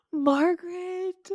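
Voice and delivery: gasp, then high, drawn-out name; mock shock. Friend reacting to a childhood confession, mock shock. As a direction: gasp and stretch the name in scandalised disbelief.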